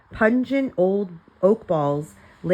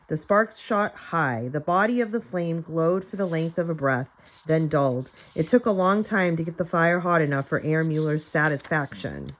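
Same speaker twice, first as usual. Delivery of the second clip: plain reading voice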